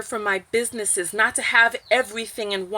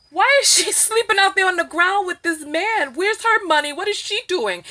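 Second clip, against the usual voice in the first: incredulous outrage; 8 semitones higher, 3 dB louder than usual. Retold or imitated. Acts out outraged reaction to meme.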